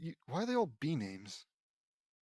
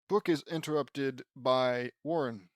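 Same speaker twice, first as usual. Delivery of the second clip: usual speaking voice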